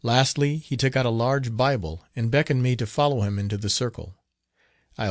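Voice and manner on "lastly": usual speaking voice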